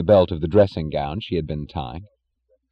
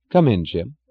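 usual speaking voice

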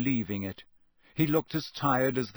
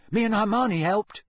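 quick; 9 semitones higher, 5 dB louder than usual. A narrator's reading. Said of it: "Ron said quickly".

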